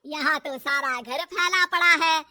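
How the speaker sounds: slow, loud, sarcastic telling-off; loud exasperated mother-in-law; angry scolding. Acted. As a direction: drag out the words, then bark the order. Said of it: "गुस्सा आ जाता है".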